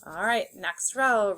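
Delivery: ordinary talk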